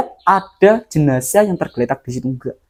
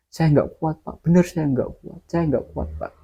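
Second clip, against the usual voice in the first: weak admission; 6 dB quieter than usual. Retold or imitated. Exhausted guard confesses weakness.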